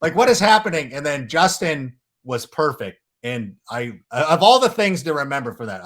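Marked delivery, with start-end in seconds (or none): 0.0-0.9 Terry Benedict. Retold or imitated. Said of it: "I'm Terry Benedict figuring out that my casino got robbed".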